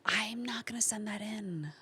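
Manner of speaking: hushed whisper, soft and breathy. Retold or imitated. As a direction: whisper it like bad news. Retelling manager's verdict.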